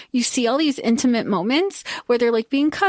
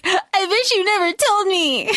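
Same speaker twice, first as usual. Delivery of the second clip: high, hammed-up wail; overplayed regretful cry; mock lament; 8 semitones higher than usual. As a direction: whine it out, pitched way up. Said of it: "and then he's like".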